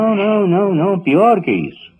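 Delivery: high, insistent no no no; emphatic denial. Acted. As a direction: protest sharply, pitch rising. Mother figure protests strongly.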